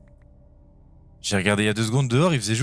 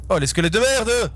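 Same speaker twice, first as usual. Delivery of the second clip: frustrated yell; 10 semitones higher, 4 dB louder than usual. Real, not acted. Ambushed by skeletons in the game.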